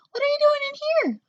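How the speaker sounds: very high, very fast squeaky voice; high fast mouse voice; surprised mouse. A narrator's reading. Dog meets caged mice.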